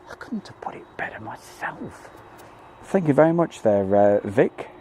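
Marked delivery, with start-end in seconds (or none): 0.1-2.1 soft unvoiced whisper; whispered aside. Real, not acted. Whispered aside after reading comment.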